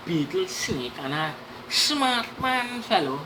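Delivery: plain reading voice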